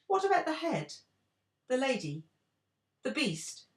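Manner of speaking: high, loud outcry; crying out. A narrator's reading. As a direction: cry out each question. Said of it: "cried the friends".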